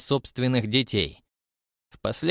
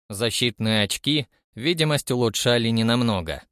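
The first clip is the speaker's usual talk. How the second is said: ordinary delivery, nothing marked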